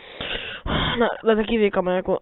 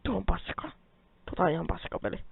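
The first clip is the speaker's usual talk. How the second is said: whispered, much softer complaint; whispered grumble; 2 semitones lower, 11 dB quieter than usual. Real, not acted. Gamer fails to get coal, whispered gripe. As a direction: hiss it under your breath.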